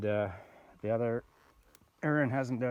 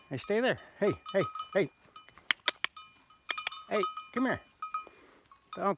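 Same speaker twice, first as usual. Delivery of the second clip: calling an animal; 11 semitones higher than usual. Real, not acted. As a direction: coax the horse to stay and come back. Calling to a horse.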